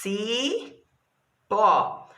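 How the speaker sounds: "Cipó" as a long ci, pause, pó; syllable by syllable. Shown as a demonstration. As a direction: stretch and split "Cipó" in two. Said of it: "separação das sílabas". ("Cipó" alongado, separando as sílabas)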